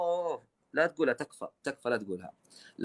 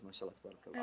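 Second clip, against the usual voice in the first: whisper; 4 semitones lower, 17 dB quieter, faster than usual. Real, not acted. Host blessing guest's English softly.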